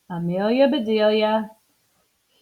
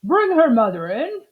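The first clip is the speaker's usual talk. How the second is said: firm doctor's instruction; doctor's order; 5 semitones higher, 4 dB louder than usual. Acted. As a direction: state it as a plain order. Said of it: "said the doctor".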